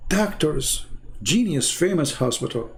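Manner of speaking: usual speaking voice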